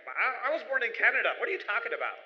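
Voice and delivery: the new generation shrugging off the past, impatient; dismissive youth. Retold or imitated. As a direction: brush it all off like a bored Canadian-born kid. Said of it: "they're like".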